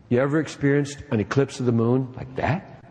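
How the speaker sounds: ordinary talk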